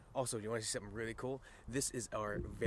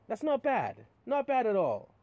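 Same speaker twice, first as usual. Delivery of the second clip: pleased; 10 semitones higher, 10 dB louder than usual. Real, not acted. Pleased with clean tanks.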